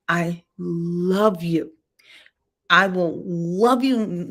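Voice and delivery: slow, drawn-out loving words; tender. Pretend. Parent assuring a twelve-year-old child, slow and tender.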